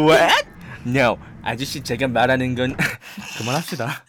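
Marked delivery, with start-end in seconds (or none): 0.0-2.8 put-on Korean American accent. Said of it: "계속해주세요".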